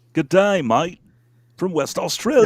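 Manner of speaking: broad put-on Australian accent. Redoing the fan's greeting in a stronger Australian accent. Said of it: "when I try to do a, a Australian accent".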